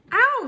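very high, loud ow; yelp of pain. A narrator's reading. Car gets landed on, yelping high and loud.

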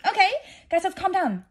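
high, loud, panicky self-calming. Real, not acted. Reader steadying viewers mid-book, panicky. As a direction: try to calm everyone while panicking.

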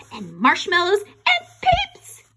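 plain reading voice

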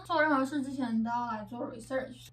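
ordinary delivery

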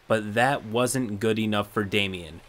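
ordinary talk

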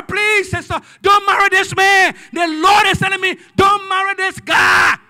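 loud, pleading warning, dragging the don't; pleading shout. Retold or imitated. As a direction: beg her at the top of your voice, slow and urgent. Pleading sermon story, pastor warning.

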